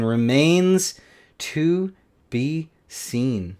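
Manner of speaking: very slow, each word stretched; drawn-out musing. Real, not acted. Repeats it drawn out.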